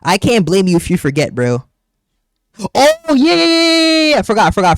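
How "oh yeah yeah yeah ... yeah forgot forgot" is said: excited. Real, not acted. Hyped reaction to being teased.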